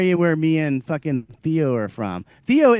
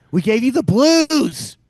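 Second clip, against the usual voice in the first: proud shout; 7 semitones higher, 7 dB louder, slower than usual. Real, not acted. Proud rant about the South.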